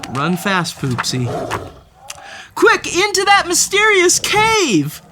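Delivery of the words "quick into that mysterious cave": excited urging. Acted. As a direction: hurry her along. Directing cat in a chase.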